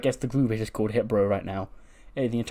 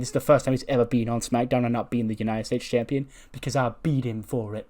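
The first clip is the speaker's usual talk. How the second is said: ordinary delivery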